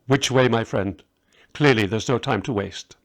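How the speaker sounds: plain reading voice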